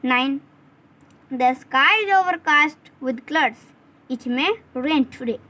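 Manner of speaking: slow, word by word for dictation. Shown as a demonstration. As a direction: read it out slowly so they can write. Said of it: "आप लिख लीजिए".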